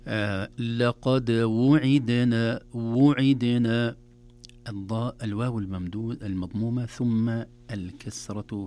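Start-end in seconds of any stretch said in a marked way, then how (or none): 0.6-2.6 وعدنا langsam, Damma am Waw und Kasra am Ain klar getrennt; sorgfältige Aussprache. Shown as a demonstration. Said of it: "تحتاج إلى عناية". 2.7-4.0 وعدنا langsam, Damma am Waw und Kasra am Ain klar getrennt; sorgfältige Aussprache. Shown as a demonstration. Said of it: "تحتاج إلى عناية".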